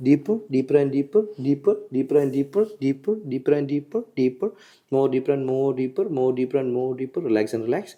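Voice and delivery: hypnotist's slow, droning countdown into trance; hypnotic induction. Real, not acted. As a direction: draw out each repetition, lulling them down.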